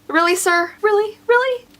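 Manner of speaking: mock disbelief climbing with every question; incredulous. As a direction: needle him with rising incredulity. Mocking the pedestrian's fuss.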